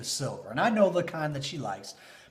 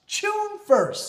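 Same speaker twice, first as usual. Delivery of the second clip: June first again, higher still; dramatic repeat; 7 dB louder than usual. Retold or imitated. Husband savoring his wife's birthday date, excited. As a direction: echo the date like a fanfare.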